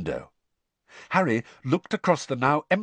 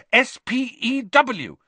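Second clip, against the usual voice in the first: S P E W spelled slowly, high and louder; hotly; 5 dB louder, slower than usual. Acted. Hermione correcting Ron, spelling each letter hotly. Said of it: "said Hermione hotly".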